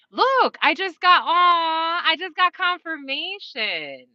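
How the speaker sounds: high, slow, choked-up gush; mock emotional. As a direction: act overwhelmed with relief, a bit hammed. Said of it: "you're just like".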